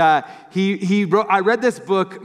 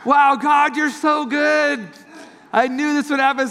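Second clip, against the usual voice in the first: excited; 9 semitones higher than usual. Retold or imitated. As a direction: shout it out in joyful thanks. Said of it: "got so excited".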